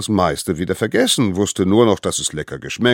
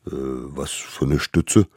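slow, low, befuddled beast; slow confused mammoth; 4 semitones lower than usual. Acted. Mammoth misunderstands the word, slow and low. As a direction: drag it out in confusion.

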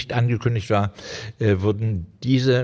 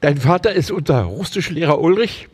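a touch of alarm, higher and rising; horrified question; 7 semitones higher, 5 dB louder than usual. A narrator's reading. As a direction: ask in mild disbelief and fright. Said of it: "fragte sie entsetzt".

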